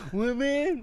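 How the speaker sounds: high, loud confused squeak; hammed-up dopey deer; clueless cartoon deer. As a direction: squeak like you missed the warning.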